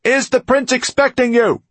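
put-on loud, high young sentry's challenge; young guard. A narrator's reading. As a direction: challenge the visitor as a youthful guard. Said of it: "guard who spoke seemed to still be very young".

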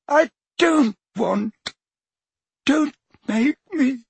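put-on high, slow frightened old man; frightened pleading. A narrator's reading. Dumbledore begging Harry in fear, high frightened plea. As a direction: plead like a terrified child. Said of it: "a voice Harry did not recognize frightened".

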